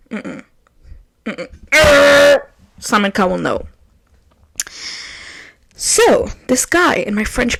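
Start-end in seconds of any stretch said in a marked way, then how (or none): 1.7-2.5 a loud reject buzzer Err; loud buzzer noise. Acted. Rejecting Nathan, a loud buzzer. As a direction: buzz it like a game show reject.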